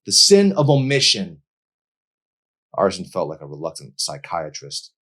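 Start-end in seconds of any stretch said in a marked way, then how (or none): 0.0-1.4 high, loud accusing retort; louder. A narrator's reading. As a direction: raise your voice to accuse him. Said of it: "Emery's voice got louder".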